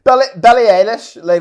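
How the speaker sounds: ordinary talk, nothing marked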